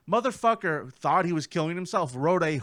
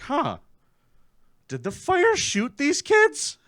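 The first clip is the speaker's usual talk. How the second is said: puzzled investigators; 10 semitones higher, 8 dB louder than usual. Retold or imitated. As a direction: play dumb detectives wondering. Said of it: "they're like".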